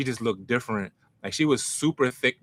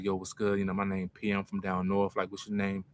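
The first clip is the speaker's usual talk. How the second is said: lower, softer smooth pickup voice; dropped little voice; 4 semitones lower, 6 dB quieter than usual. Retold or imitated. To a girl he pulls over for, low smooth pickup voice. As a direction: play it cool and low to charm her. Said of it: "drop the little voice".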